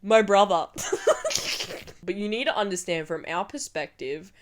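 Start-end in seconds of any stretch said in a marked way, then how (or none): 0.7-2.0 squeaky unvoiced giggle; high breathless laugh. Real, not acted. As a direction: burst into a high silent laugh. Laughing at brother kicked out.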